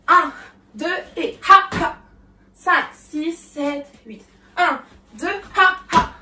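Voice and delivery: put-on loud ha accents on the beat; counting with shouted ha. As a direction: punch each ha. (ha ha criés sur le temps)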